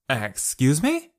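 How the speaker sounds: rising, indignant "Excuse me?" at normal volume; angry retort. A narrator's reading. Kate turns on Sho, indignant. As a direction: bristle with offence. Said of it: "Kate turned to face Sho angrily".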